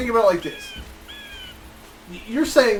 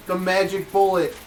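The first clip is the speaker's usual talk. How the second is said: ordinary delivery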